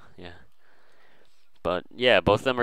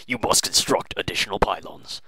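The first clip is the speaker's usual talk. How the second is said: game advisor voice. Retold or imitated. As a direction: imitate the in-game announcer. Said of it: "you will hear the voice".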